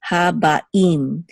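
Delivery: Habaim split as ha-ba, im; separated sounds. Shown as a demonstration. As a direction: pause before the im of Habaim. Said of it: "And even here".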